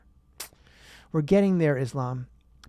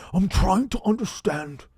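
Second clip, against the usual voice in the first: high, slow, halting senator impression; Bernie Sanders; 5 semitones higher, slower than usual. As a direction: drag out the words like a puzzled politician. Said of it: "this is a bad impression".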